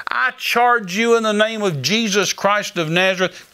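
slow, high command called across a field; calling out. Retold or imitated. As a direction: call him back with full authority, hands cupped. Cupped hands, calls thief back.

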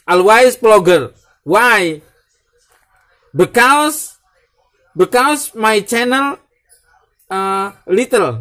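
high, loud, slow broken English; hammed-up English. Vlogger hamming up a broken English explanation. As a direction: stretch it out proudly.

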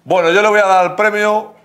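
alto, agudo y pausado; a gritos. Real, not acted. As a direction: habla a voces, como sin micrófono. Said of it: "Pero por qué gritas". (gritando, más alto de la cuenta)

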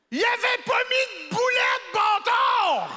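high, loud disbelieving yell; outraged shout. Acted. Comic shouting to the crowd about his burger, outraged. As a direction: explode at the missing patty. Discovers missing patty, outraged.

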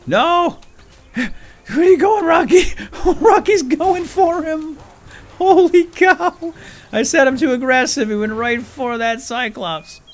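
high, loud laughing shouts; laughing and shouting as the pet runs off; excited laughing yells. Real, not acted.

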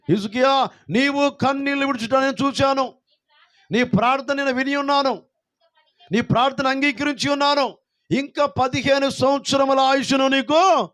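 high, proclaiming divine voice; voice of God. Acted. God speaking to King Hezekiah, high proclaiming. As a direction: announce the promise as God. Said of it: "దేవుడు మాట్లాడుతున్నాడు".

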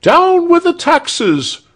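very high, slow shrieked slogan; crowd shriek. A narrator's reading. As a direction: shriek it out like a mob. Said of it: "shrieked the crowd".

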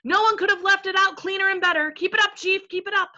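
high, loud chorus of agreement; enthusiastic roar. A narrator's reading. Other voices back their chief, roaring enthusiasm. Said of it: "roared the other voices with great enthusiasm".

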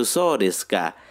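"So desu ka?" with the pitch falling at the end, not a question; "So desu ka?" like "oh, I see", the end going down; falling tune. Shown as a demonstration. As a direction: say "So desu ka?" as a calm acknowledgement with ka dropping. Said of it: "jadi intonasi pada akhir tidak dinaikkan".

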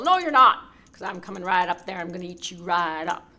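loud threatening troll voice; angry troll. Acted.

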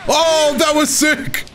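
high, drawn-out thrilled cry; amazed excitement. Real, not acted. Player amazed by the opponent's move, high drawn-out excitement. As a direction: cry out high, slowly stretching it in awe.

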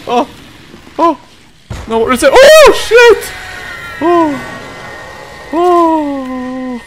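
shrill, loud real screams; screaming in genuine terror; terrified screaming. Real, not acted. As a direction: shriek at the jump scare. Jump scare from pursuer.

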